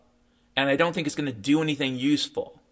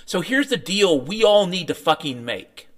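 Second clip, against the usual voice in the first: ordinary talk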